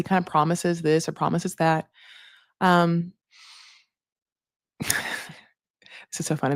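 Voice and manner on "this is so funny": laughing whisper. Real, not acted. Laughing aside after a memory.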